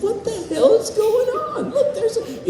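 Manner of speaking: high, laughing, half-incredulous; retold disbelief. Asking Vincent mid-scene, high and laughing. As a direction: squeal it in mock shock through laughter. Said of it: "I said".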